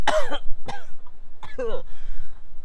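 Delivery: a sudden high choking cough; startled cough. Acted. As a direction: splutter in surprise. Merchant reacts to ambush news.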